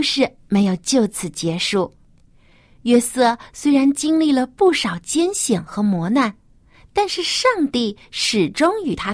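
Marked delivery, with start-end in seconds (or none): none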